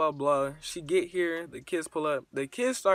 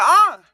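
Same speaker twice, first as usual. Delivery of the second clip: sudden high shriek; scream; 11 semitones higher, 16 dB louder than usual. Retold or imitated. Girlfriend shrieking in pain, a high scream. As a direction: shriek high and loud, caught off guard. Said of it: "this bitch screams".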